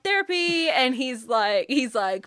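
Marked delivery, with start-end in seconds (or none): 0.0-0.7 high, louder eager blurt; eager exclamation. Retold or imitated. She suggests therapy to anyone complaining, eagerly. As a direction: pitch it like the cure for everything. Her stock answer, acted out.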